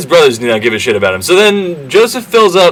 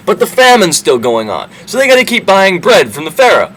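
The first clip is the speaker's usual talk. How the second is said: ordinary talk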